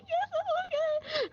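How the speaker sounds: high, slow, teary voice; slow high tearful reply; imitated near crying. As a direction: squeak it out as if holding back tears. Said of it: "she's, like, almost crying".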